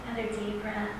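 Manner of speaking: soft, calm breathing-exercise voice; guided breathing. Acted.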